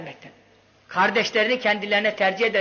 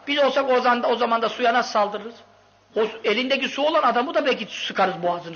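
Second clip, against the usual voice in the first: ordinary talk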